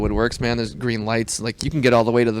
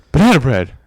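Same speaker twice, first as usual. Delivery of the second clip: Isaac Whitty impression. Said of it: "Do your Isaac Whitty".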